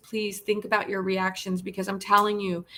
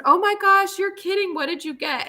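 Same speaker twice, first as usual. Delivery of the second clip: much higher, bright oh my gosh, retold excitement; delighted surprise; 10 semitones higher, 3 dB louder than usual. Hearing from Martha on the phone about a new dog, retold delight. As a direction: replay the happy shock at the news. Retelling her reaction on the phone.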